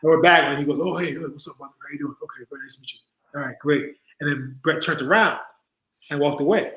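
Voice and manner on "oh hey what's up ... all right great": soft, indifferent mock pleasantries; distracted, brushed-off greeting. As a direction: imitate a polite brush-off, very quietly. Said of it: "he didn't really focus on it".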